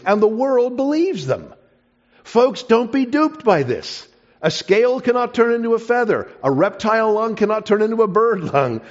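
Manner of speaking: rally the folks against being duped; preaching at full force. Acted. Speaker warning the congregation, emphatic preaching.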